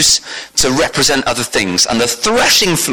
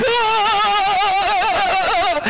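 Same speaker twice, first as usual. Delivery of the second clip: long, high-pitched mock scream; cowering wail; 3 dB quieter than usual. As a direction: imitate a terrified army's thin, drawn-out wail. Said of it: "the Israelite army cowering on the mountain going".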